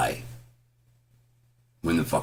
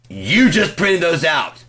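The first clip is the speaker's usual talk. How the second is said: exasperated yell; 10 semitones higher, 6 dB louder, slower than usual. Retold or imitated. Retold yelling at Deb.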